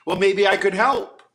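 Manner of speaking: suddenly loud, bright offer of help; eager offer. Acted. The beggar eagerly offers to help the poor town. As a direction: burst in with cheerful eagerness.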